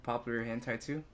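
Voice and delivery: ordinary talk, nothing marked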